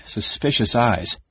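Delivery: ordinary delivery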